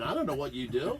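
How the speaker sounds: ordinary talk, nothing marked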